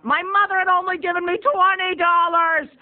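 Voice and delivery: loud, high-pitched comic wail; panicked outburst. Retold or imitated. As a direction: cry out in high, loud dismay. Panicked outburst about money.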